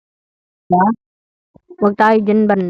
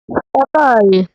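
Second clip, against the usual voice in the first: ordinary delivery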